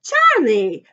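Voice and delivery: loud, falling shout of the name; big booming greeting; delighted cry. A narrator's reading. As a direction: cry out the name in joyful recognition. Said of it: "cried Mister Wonka".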